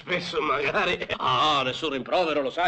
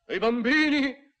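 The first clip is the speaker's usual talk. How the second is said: anguished outcry; 8 semitones higher than usual. Acted. Horrified recital of violence.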